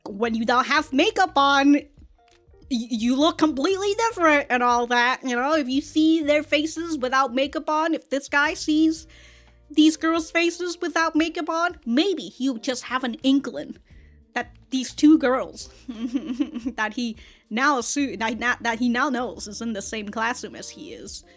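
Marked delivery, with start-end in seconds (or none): none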